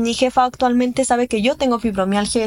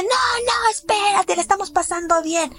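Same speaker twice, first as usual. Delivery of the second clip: high, pleading imitation of a partygoer; mocking pushy friend; 9 semitones higher than usual. Imitating friends pressuring her to stay at party, high mock plea. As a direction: mimic someone begging her to stay, pitched way up. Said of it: "Sin presionarme de".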